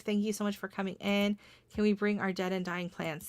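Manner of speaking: ordinary delivery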